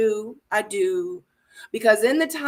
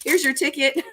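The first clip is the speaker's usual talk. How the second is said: higher, sing-song mock offer; Zadia's voice, mock sweet; 5 semitones higher than usual. Zadia offering Cam an easy ride, high teasing voice. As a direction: dangle it like a cheeky gift. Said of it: "She said".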